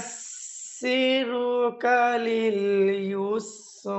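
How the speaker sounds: measured, drawn-out tajweed chant; teacher recites each word slowly for students; slow Quran recitation. Shown as a demonstration. Teacher reciting to students, in slow drawn-out tajweed chant.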